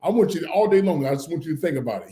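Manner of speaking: ordinary talk, nothing marked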